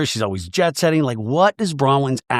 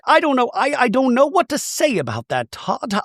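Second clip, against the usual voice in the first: high-pitched imitation of a wife close to tears; mock teary; 11 semitones higher than usual. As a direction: pitch it way up, as if welling up. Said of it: "like, a little teary".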